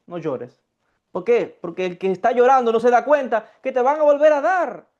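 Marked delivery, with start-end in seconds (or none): 0.1-0.6 gently lower, softer no llores; soft, low comfort. Real, not acted. Teacher comforting a grieving listener, soft and low. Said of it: "Cuando tengas que soltarlo".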